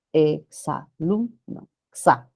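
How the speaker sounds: slow, low "Exalumno" as e, xa, lum, no, then "Xa"; "Exalumno" in beats, x joined to the a; syllable by syllable. Shown as a demonstration. Teacher points students to the pronunciation, syllabified. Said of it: "La pronunciación".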